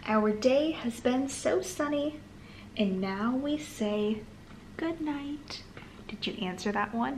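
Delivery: ordinary delivery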